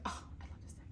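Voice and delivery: soft unvoiced whisper of love; whispered adoration. Real, not acted. Whispering to herself over a beloved deck. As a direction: breathe it out in awe.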